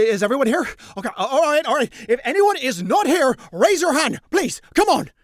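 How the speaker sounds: high, loud, pleading roll call; flustered calling out. Acted. Colline flustered at roll call, high loud pleading. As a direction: plead with the room for an answer.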